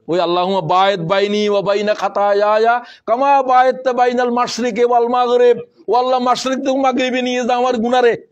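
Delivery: preacher reciting the prophetic supplication; dua recitation. Acted. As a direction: pray earnestly aloud.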